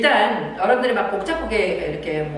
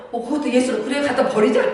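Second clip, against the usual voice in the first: laughing, louder cheerful go-along reply; wrong mock agreement; 6 semitones higher, 4 dB louder than usual. Shown as a demonstration. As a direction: jokingly agree with the child the wrong way. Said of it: "이게 예스가 아니고요". (웃으며 맞장구치는 잘못된 대답)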